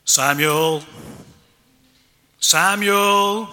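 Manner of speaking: loud booming God voice. Acted. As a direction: call out like a booming deity. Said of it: "I need to kind of do a booming voice".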